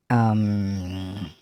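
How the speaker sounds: ordinary talk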